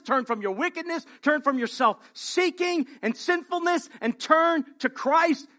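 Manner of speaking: high, loud pleading preacher; preacher's impassioned call to repent; urgent gospel call. Acted. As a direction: cry out the warning to turn. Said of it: "The gospel call goes out for us and says".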